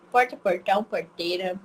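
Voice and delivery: put-on interior Goiás accent with retroflex R in "porta"; Goiás countryside accent. Teacher imitating her professor from Goiás, countryside accent. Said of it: "ela é do interior de Goiás".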